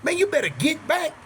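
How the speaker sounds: high-pitched retold warning; imitated frantic warning without full volume; hollering. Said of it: "So I'm hollering".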